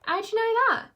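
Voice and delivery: very high, astonished llama; impressed surprise. A narrator's reading. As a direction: ask it high, amazed she knows. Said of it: "Levi seemed impressed".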